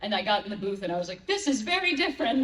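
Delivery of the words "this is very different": higher-pitched, laughing disbelief; comic dawning panic, voice raised in pitch; mock alarm. Guest recalls getting in the booth, amused and taken aback. Retells shock in the recording booth.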